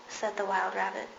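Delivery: plain reading voice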